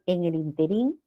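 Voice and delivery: interín stressed on the last syllable, rising; interín said as in-te-RÍN; common mispronunciation. Retold or imitated. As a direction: lift and lengthen the RÍN in interín. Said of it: "si se pronuncia así".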